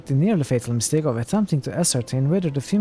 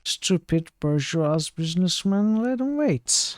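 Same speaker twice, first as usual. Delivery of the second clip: put-on high, slurred drunk voice, lost and confused; drunk man; 6 semitones higher, slower than usual. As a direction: play the drunk squinting at a stranger, pitched way up. Drunk fellow sneering.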